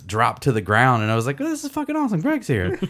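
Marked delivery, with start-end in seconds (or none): 1.4-2.8 high, delighted squeal; thrilled. Retold or imitated. [name] relieved it's Greg, high delighted gush.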